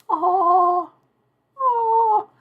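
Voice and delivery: high, drawn-out oh, oh; melting at the pretty costume; awed oh. Retold or imitated. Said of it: "I'm just like".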